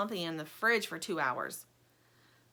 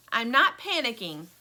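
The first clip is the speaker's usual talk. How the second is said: proudly; 3 semitones higher, 8 dB louder than usual. Acted. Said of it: "Stingray says proudly".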